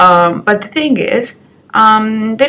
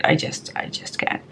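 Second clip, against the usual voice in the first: exasperated mutter; 10 dB quieter than usual. Real, not acted. Rejecting head tilts in business, soft exasperated mutter. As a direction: repeat it as a soft, unvoiced, defeated mutter. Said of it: "I just can't do it".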